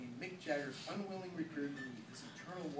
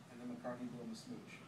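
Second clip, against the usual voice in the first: plain reading voice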